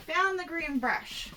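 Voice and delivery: normal talking voice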